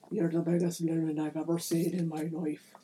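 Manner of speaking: low-voiced leprechaun in a put-on accent. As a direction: play an admiring leprechaun, pitched a little low. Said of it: "One of them is like".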